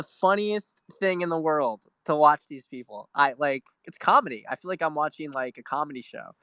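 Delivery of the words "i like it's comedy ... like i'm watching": normal talking voice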